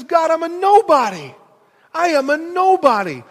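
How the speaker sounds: desperate Moses voice; pleading Moses. Acted. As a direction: cry out in protest. Moses protesting to God.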